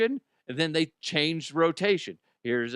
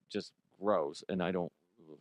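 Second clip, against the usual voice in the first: usual speaking voice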